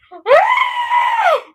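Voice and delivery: loud, very high squeal; piercing cringe squeal; high-pitched squeal. Real, not acted. Recoils at cringey gossip.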